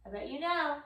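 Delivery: ordinary delivery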